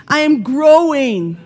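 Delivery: mean it this time; with conviction. Shown as a demonstration. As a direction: belt out the affirmation. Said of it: "Are you growing?".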